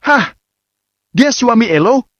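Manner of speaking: high shocked gasp of a question; stunned disbelief. A narrator's reading. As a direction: blurt it out in disbelief. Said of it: "mereka bertanya serempak".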